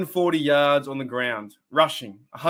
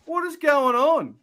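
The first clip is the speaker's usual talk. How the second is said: much higher, bursting out with a laugh; startled surprise; 12 semitones higher, slower than usual. Real, not acted. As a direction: caught completely off guard, voice jumping up. Sudden surprise raid arrives on stream.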